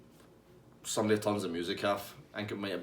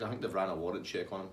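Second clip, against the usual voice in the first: normal talking voice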